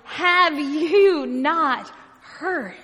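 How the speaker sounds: very slow, drawn-out disbelief; astounded. Acted. Astounded travelers answering the stranger on the road, incredulous. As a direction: stretch each word in acted astonishment. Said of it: "And astounded, they respond".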